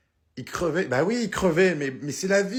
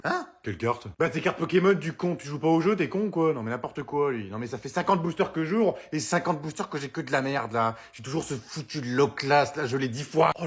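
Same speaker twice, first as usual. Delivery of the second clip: ordinary delivery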